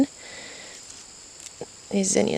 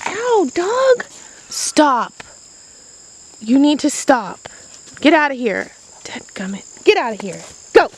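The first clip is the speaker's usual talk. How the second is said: sharp loud commands, higher; yelling at dog; 5 semitones higher, 4 dB louder than usual. Real, not acted. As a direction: shout the dog away. Dog jumps at her, she yells.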